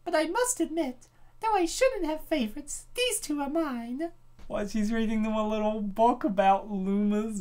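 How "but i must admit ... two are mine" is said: high, sweet motherly voice; Rosalina voice. Acted. Rosalina confiding to readers about her favorite Lumas, high and sweet. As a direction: coo it like a doting space mother.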